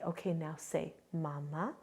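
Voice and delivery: put-on soft, low prompt to a toddler; coaching parent. Parent teaching a toddler to talk, gentle prompt. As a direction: quietly model the word, lifting at the end. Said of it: "then they would say".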